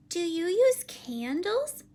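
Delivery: high, loud, slow question; surprised child's question. Acted. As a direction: ask it slowly in wide-eyed surprise. Child puzzled by the candle.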